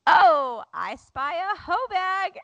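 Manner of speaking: high, slow playful game voice; sing-song I Spy. Retold or imitated. Overtired mom teasing during car game, high sing-song. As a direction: call it out like an I Spy clue. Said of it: "and I go".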